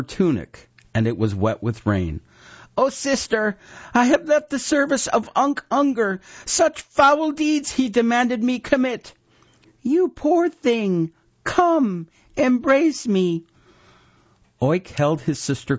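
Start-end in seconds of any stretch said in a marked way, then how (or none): 2.8-9.1 put-on high, louder upset boy; distraught boy. A narrator's reading. Oik crying to his sister. 9.8-13.5 high, slow sweet woman's voice; false tender sister. A narrator's reading.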